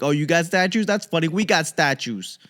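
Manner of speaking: hammed-up Boston local; cocky Boston guy. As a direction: scoff at every city like a hometown loudmouth. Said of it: "you're like".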